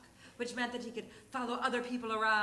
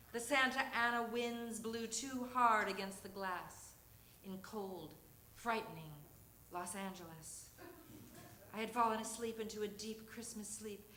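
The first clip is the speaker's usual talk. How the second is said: normal talking voice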